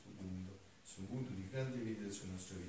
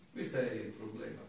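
ordinary talk, nothing marked

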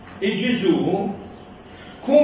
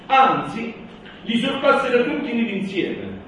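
raised, louder solemn proclamation; preaching at full force; 8 semitones higher, 4 dB louder than usual. Acted. Rereads the quote.

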